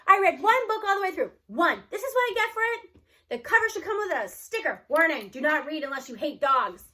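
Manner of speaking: loud, high, outraged complaint; a boy furious at a sad book; indignant rant. Acted. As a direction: vent the betrayal at full volume. Boy ranting over sad ending.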